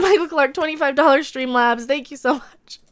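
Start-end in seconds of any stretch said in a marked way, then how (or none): none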